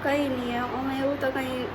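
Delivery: soft, hesitant reply she's told to speak up from; too quiet. Real, not acted. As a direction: murmur it shyly, hardly voiced. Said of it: "મોય મોટેથી બોલ ને".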